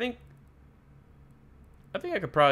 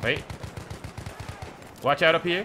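loud, higher warning shout; urgent warning; 5 semitones higher, 4 dB louder than usual. Real, not acted. Streamer warning teammates of enemies ahead, loud call. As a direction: alert the squad.

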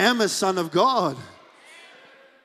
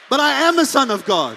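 "am" hit hard, louder and higher; bold shout; 4 semitones higher, 6 dB louder than usual. Shown as a demonstration. Preacher declaring to the congregation, a bold shout. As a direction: declare it boldly.

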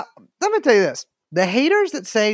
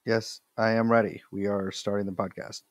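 deep voice; 11 semitones lower, 7 dB quieter than usual. Pretend. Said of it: "Why are you talking?".

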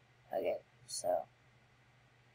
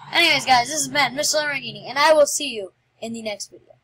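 loud, higher sign-off; energetic outro; 5 semitones higher, 8 dB louder than usual. Real, not acted. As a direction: beam it out to viewers. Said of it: "channel outro sign-off".

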